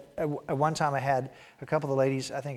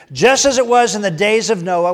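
loud, raised reading of Jesus' words; preacher reading scripture aloud, loud and raised; scripture reading at full force; 7 semitones higher, 15 dB louder than usual. Acted. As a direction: proclaim the passage to the congregation.

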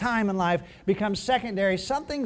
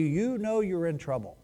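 usual speaking voice

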